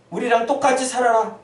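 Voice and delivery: firm, pressing command to conform; pushy demand. Acted. Preacher voicing the world's demand, pressing and firm. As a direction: demand it outright, full voice.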